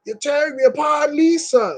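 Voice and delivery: high, shouted anguished movie line; high anguished shout, tearing me apart; Tommy Wiseau quote. Retold or imitated. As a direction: yell it like a tortured melodrama hero. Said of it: "he goes".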